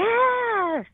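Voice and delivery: high, long-drawn "Yes"; imitated triumphant yes. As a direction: mimic relief at finally being understood. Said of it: "like that".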